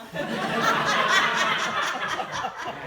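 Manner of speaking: a big, high burst of real laughter; hearty loud laugh. Real, not acted. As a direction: laugh out loud at your own joke. Laughs at his own joke.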